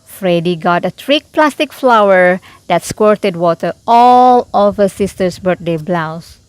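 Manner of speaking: ordinary delivery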